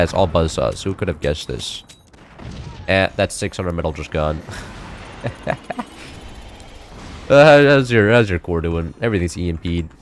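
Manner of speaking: ordinary talk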